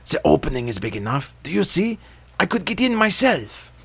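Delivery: show-off cranky witch; impatient witch. A narrator's reading. Witch speaks.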